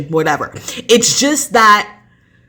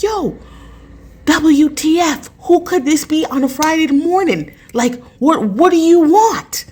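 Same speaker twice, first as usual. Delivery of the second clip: hammed-up irritation, pitched up; mock annoyed; 9 semitones higher than usual. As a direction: act put out by the pounding. Retold annoyed reaction to knocking.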